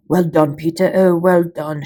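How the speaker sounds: excited shout of encouragement. A narrator's reading. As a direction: cheer your brother on from the sidelines. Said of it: "shouted Edmund".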